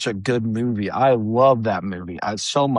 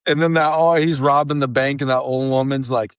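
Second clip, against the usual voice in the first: ordinary delivery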